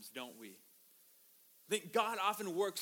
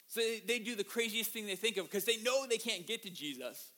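usual speaking voice

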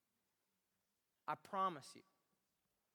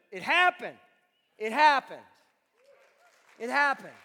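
loud, hammered declaration; emphatic declaration; 11 dB louder than usual. Real, not acted. Preacher insisting to the congregation it happened, loud emphatic. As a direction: land it like a verdict.

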